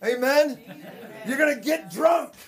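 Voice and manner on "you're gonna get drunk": shouted, higher revival preaching; fiery preaching. Acted. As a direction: preach it out with full fire.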